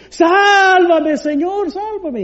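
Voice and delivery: high, pleading cry for rescue; desperate plea. Retold or imitated. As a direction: beg like a man sinking in the waves. Pedro hundiéndose, pide auxilio. (súplica desesperada y aguda)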